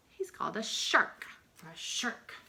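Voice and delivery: ordinary talk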